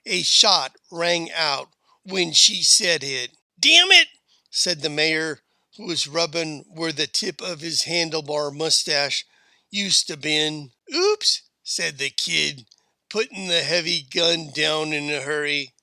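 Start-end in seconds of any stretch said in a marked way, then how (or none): 3.6-4.1 high, loud burst of cursing; angry yelp. A narrator's reading. Mayor cursing after a stray shot, loud and outraged. 10.9-11.4 tiny high-pitched oops; kid's oops. A narrator's reading. Kid who fired by accident, high startled squeak.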